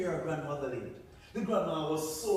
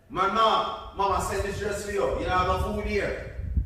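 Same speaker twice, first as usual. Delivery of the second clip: louder, fast and bossy; rude girl; 4 semitones higher, 10 dB louder than usual. Acted. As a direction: complain at grandma. Buddy arrives rude.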